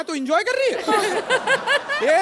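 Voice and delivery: high, slow, stretched-out disbelief; incredulous protest. Acted. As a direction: squeal in disbelief at her, dragging it out. Thug baffled that victim enjoys it. (हैरानी में ऊँची, खिंची हुई शिकायत)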